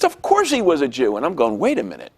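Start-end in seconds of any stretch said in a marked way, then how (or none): 0.0-1.2 higher, emphatic retort; exasperated rabbi. Retold or imitated. Rabbi answers the pupil again, exasperated. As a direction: lightly imitate an indignant teacher.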